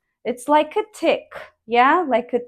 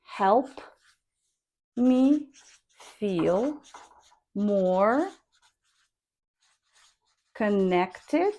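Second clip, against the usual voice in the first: Help me feel more connected said one word at a time, softly; slow word-by-word drill; 2 semitones lower, 4 dB quieter, much slower than usual. Shown as a demonstration. Teacher dictating word by word for the class, slow and soft. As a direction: drill each word with pauses. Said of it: "should go with rising tone".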